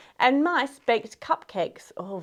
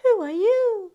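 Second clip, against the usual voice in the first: put-on high, questioning girl's voice; Cinderella; 8 semitones higher than usual. Cinderella questions the stranger who appeared, high-voiced. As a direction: ask it in a high storybook voice. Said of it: "asked Cinderella".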